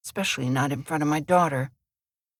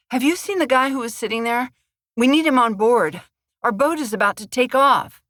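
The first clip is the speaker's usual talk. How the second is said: out of breath; 6 semitones higher, 4 dB louder than usual. A narrator's reading. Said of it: "Panting and out of breath".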